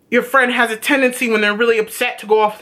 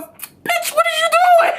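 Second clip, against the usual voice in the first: much higher, squealed mock outburst; a sudden, very high cry of disbelief; imitating Harris. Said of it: "He goes".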